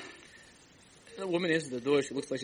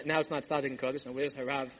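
usual speaking voice

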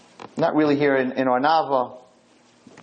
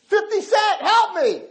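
desperate scream; slower than usual. Retold or imitated. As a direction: shriek at the idol to save you. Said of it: "started to scream the name".

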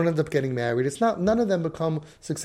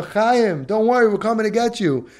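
high, raised rescuer's call; screaming down; 6 semitones higher, 4 dB louder than usual. Acted. Rescuer to Chaim in the pit, calling out high. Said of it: "What if you scream down".

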